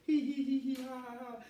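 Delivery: high-pitched mock giggle; mocking fake laugh. Preacher mimicking scoffers who think it's all a joke, mocking fake laugh. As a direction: squeal out a fake hee-hee-ha-ha.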